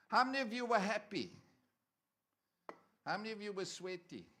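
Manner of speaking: ordinary delivery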